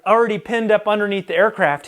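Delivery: ordinary delivery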